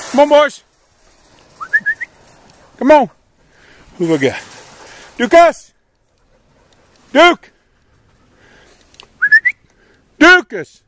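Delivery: high, loud call across the yard; ringing high-pitched dog call. Real, not acted. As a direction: shout for the dogs to come. Calling dogs across the yard.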